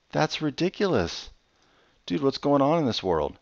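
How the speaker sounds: exasperated disbelief. Real, not acted. Game refuses to place stick.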